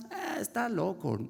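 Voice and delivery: high-pitched, offhand brush-off; mock dismissal. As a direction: wave off a warning as the careless boys would. Boys brush off the warning. (desdén imitado, sin darle importancia)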